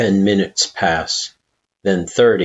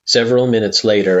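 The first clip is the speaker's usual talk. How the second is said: plain reading voice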